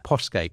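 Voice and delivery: Postgate said as "poss-gate", no T; silent T. Shown as a demonstration. Guest correcting the host on a martyr's surname, Postgate without the T. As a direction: confirm Postgate firmly with the T dropped. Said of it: "we don't pronounce the T".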